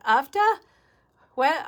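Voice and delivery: high, loud questioning echo, rising at the end; puzzled echo. Real, not acted. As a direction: repeat the strange word as a baffled question. Puzzled by unknown word from chat.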